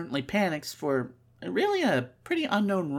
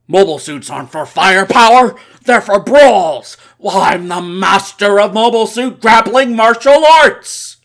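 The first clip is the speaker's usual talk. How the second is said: booming, far louder villain voice; villain monologue; 4 semitones higher, 16 dB louder than usual. Acted. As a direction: roar it like a gloating villain. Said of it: "let me see if I can do it justice".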